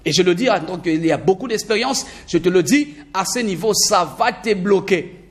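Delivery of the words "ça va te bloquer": ordinary talk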